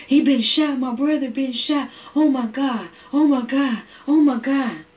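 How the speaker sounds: high, loud, frantic retelling of a brother's panic; panicked cry. Retold or imitated. Boy hearing his brother was shot, panicked high cry. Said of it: "Amir says".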